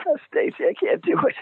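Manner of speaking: whiny panic. Retold or imitated. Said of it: "And I go".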